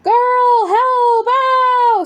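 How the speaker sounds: very high, loud pained cry for help; the hurt unicorn shouting for help; booming cry. Acted. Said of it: "a large booming voice interrupted".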